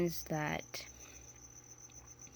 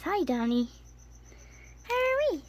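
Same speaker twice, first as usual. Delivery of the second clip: much higher, squeaky pet voice; 11 semitones higher than usual. Real, not acted. Owner gushing over her tiny tarantula in baby talk. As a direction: gush over a cute pet.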